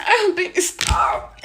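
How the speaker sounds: high, whiny flirty mock.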